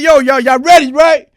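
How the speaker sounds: high, very loud callout; retold rallying shout. Inmate calling to everybody in their cells, rallying shout. Retold call to the whole cellblock.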